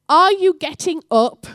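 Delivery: high, loud wake-up demand; loud challenge. Acted. God rousing the church to get up, in a loud challenge. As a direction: call it out to rouse the listeners. God's wake-up call, echoing the shout.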